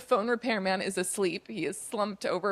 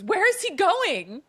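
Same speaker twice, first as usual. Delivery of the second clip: demanding; 11 semitones higher, 6 dB louder, slower than usual. Retold or imitated. Lucia finally demands an answer.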